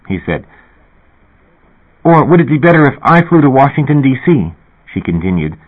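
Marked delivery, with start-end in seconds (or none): 2.0-4.5 put-on higher, eager young woman, fast; excited singer asking a favor, higher voice; Linda Fox. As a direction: rush through the request, pitched up.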